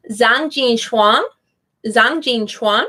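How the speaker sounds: "Zhang Jin Chuan" with English-accented Zh and an "ah" vowel; Mandarin name in an English accent. Shown as a demonstration. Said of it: "saying it with an English accent".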